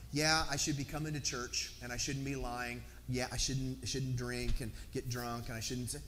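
a hint of a bored believer reciting shoulds; mock churchgoer. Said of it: "what if Christianity wasn't about".

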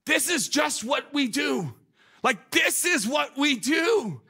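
high, loud, slow, drawn-out insistence; emphatic insistence. Retold or imitated. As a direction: stress it as obvious routine. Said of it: "And she goes".

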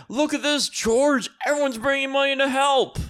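high, excited mock-elated voice; hammed-up joyful old relative; Uncle Bill. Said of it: "Uncle Bill was like".

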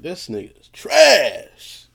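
loud, high, stretched-out last word; mock disgust. Judging the outfit his wife picked, loud mock disgust. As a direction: draw the final word out in exaggerated disdain. Acted verdict on the dressed-up husband.

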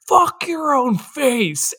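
a light imitation of the scream; mock Les Grossman yell. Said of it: "He just screams at them".

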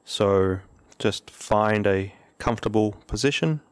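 calm, measured meditation guidance with long pauses; unhurried, even-toned meditation leader; guided meditation. Real, not acted. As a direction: ease listeners into stillness, leaving long silences between phrases.

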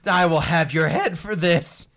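a hint of a vengeful villain; mustache twirling villain. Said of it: "As he's like".

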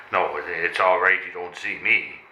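usual speaking voice

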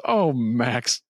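high, slow mock-weepy gush; mock-teary Grinch hugging his dog. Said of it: "he looks over at Max and he goes".

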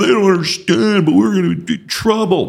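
hammed-up victim complaint to the troops; mock victim whine. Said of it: "I could say".

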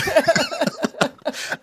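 bright, loud laughing fit; high burst of laughter. Real, not acted. Big laugh after accent joke.

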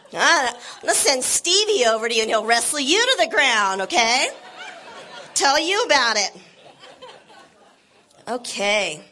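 ordinary talk

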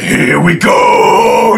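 hyped here-we-go yell; pumped-up shout. Acted. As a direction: psych yourself up and roar it. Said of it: "he's like".

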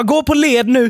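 high-pitched retold order to the kids; mock urgent parental command; parent calling out. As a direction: call it out high, like a stressed parent.

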